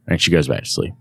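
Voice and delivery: ordinary delivery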